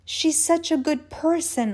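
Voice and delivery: high-pitched gushing; lifted, excited tone; heightened excitement. Acted. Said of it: "My tone heightens with excitement".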